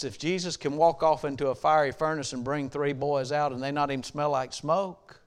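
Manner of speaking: ordinary delivery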